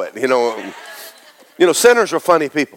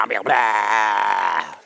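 mock retch; 5 semitones lower, 4 dB quieter, much slower than usual. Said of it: "the devils just come out of that guy".

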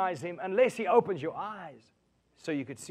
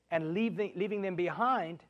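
normal talking voice

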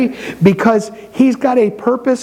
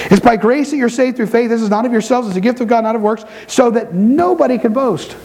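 raised preaching read; 4 semitones higher than usual. Acted. Preacher reads scripture with force.

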